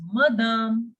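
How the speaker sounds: madame as ma-DAHM; French madame, weight on the final syllable; French pronunciation. Shown as a demonstration. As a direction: say madame with a long open second a. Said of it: "we pronounce it as".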